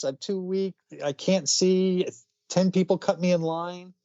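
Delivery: put-on high, pleading child voice; hammed-up sick child begging Santa; pitiful blind kid. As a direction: play the sickly little kid for pity. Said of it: "Little blind kid with a little stick and says".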